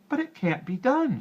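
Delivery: very high, loud protest; a frightened girl to the little man; despairing girl. A narrator's reading. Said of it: "she said".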